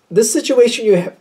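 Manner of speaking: hiccuping mid-sentence; talking through hiccups. Real, not acted. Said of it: "I got the hiccups".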